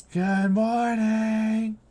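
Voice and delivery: high, slow, chanted good morning; drawn-out creepy kid chorus; eerie children's choir. Acted. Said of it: "like a choir".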